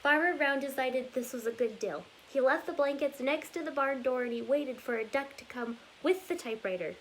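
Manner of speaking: lively storybook read-aloud, rhythmic and bouncy; reading to children. Acted. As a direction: read it like story time for little kids. Reads picture book aloud.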